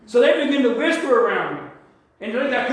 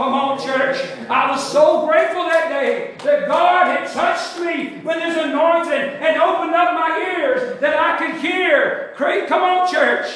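higher, louder, slow preaching build; rising preaching; 6 semitones higher, 4 dB louder, slower than usual. Real, not acted. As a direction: lift the church with mounting fervor.

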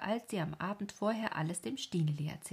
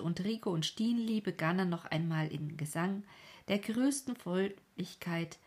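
plain reading voice

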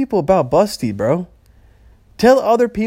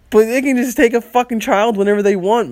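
normal talking voice

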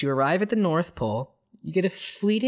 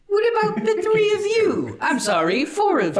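squeaky jack-in-the-box voice; high bouncy toy character; 5 dB louder than usual. Acted.

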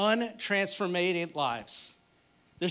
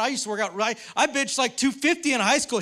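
defensive boast; 6 semitones higher, 6 dB louder than usual. Retold or imitated. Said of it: "I'm like".

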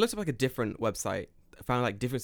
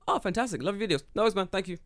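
excited fan; 8 semitones higher, faster than usual. Retold or imitated. Retold overly happy fan greeting.

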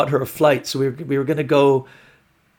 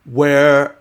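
drawn-out, louder "where", hammed up; mock growing exasperation; 5 dB louder, much slower than usual. Girlfriend's voicemail to missing boyfriend, exasperated. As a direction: imitate an exasperated voicemail, stretching it out. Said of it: "progressively more like".

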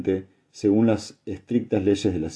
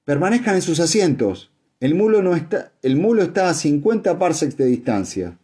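high, urgent raised-voice order; pitched-up cry of command; shout over uproar; 8 semitones higher than usual. A narrator's reading. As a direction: call out to quell a tumult. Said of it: "El grito de Imbur se hizo audible".

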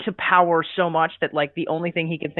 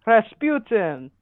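dramatic Russian pronunciation; 3 semitones higher, slower than usual. Pretend. Dramatic name announcement.

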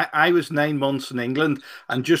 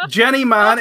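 usual speaking voice

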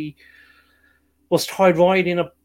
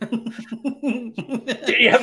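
higher, slow, laughing through a grand voice; mock theatrical politeness; 7 semitones higher, 5 dB louder, much slower than usual. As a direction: ask it like a pompous actor, barely holding a laugh. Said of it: "speak like that all the time".

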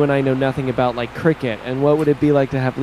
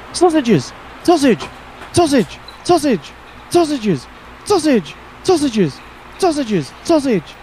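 very high yelping dog barking out sausages; a dog yapping the word over and over; excited dog; 13 semitones higher than usual. Retold or imitated. Dog in the Budweiser ad, very high excited yelps. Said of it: "and his dog is going".

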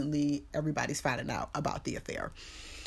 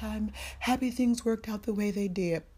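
higher, measured imitation of a therapist-like reply; therapist answer; 4 semitones higher than usual. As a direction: give a mock diplomatic answer. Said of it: "Total therapist answer".

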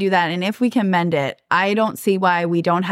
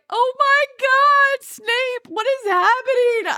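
very high, drawn-out voice of shock; high overwrought cry, stretched slow; high emotional wail; slower than usual. Retold or imitated. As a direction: squeal it out like you can't believe it. Retold emotional reaction to scene.

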